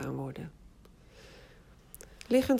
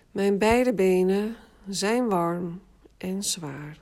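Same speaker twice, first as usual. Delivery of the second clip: slow, even autogenic formula; 4 dB louder, slower than usual. Shown as a demonstration. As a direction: say it slowly, for them to repeat. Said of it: "herhaal de zin in gedachten twee keer". (langzame formule)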